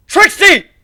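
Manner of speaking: loud, very high cry of a name; alarmed call. Acted. Calling injured rider.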